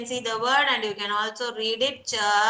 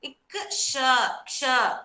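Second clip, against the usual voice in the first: normal talking voice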